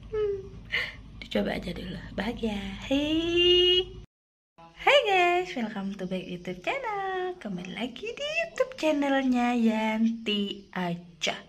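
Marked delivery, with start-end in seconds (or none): 2.9-7.4 perky YouTuber opening; bubbly vlog greeting. Acted. 7.4-11.4 channel intro. Acted.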